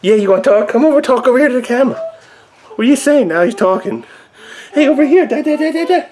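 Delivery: high singsong parent voice. Real, not acted. Coaxing baby to talk to camera.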